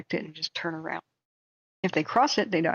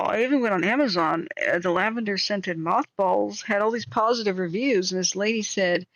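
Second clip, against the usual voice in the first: usual speaking voice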